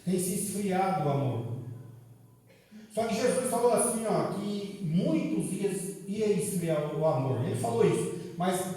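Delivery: usual speaking voice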